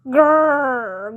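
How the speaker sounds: a rumbling growl at normal pitch; bear growl. A narrator's reading. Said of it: "growled the bear".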